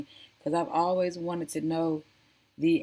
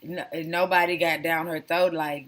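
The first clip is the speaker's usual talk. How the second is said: ordinary delivery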